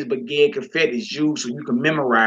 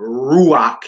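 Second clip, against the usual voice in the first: "ruach" with RU punched louder; stress on ru; 5 dB louder than usual. Shown as a demonstration. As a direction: lean hard on the ru in "ruach". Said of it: "is stressed on ru".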